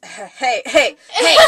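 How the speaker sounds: laughing attempt at a dude voice. Shown as a demonstration. Trying a dude voice for the co-host, laughing and hammed-up. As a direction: try a loud dude "hey", cracking up. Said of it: "let me try and do a dude voice".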